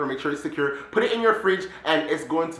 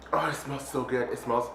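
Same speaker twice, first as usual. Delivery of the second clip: soft, low-key swoon; quiet savouring; 5 semitones lower, 9 dB quieter than usual. Real, not acted. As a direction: savour it softly, almost to yourself. Savours the smell.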